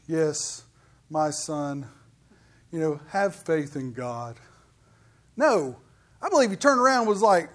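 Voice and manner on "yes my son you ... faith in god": lower, softer, mild and tender; the soft storybook Jesus everyone imagines; meek gentle Jesus. Shown as a demonstration. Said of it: "I don't believe he's just speaking in the way that we always think of Jesus".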